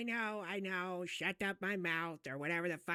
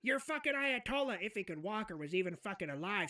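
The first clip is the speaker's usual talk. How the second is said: ordinary talk, nothing marked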